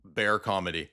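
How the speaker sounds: ordinary talk, nothing marked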